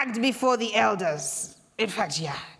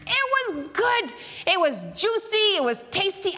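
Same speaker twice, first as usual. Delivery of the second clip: relishing; 8 semitones higher than usual. Acted. Washu relishing taste.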